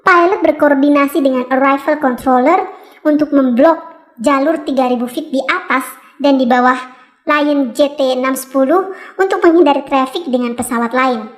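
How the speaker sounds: ordinary talk, nothing marked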